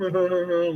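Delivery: a short high giggle; high laugh. Retold or imitated. Kids laughing during the gum challenge, a high giggle.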